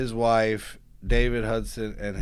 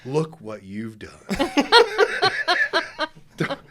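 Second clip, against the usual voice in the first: prankster joke; 4 semitones higher, 6 dB louder than usual. Retold or imitated. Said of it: "So I'm a prankster".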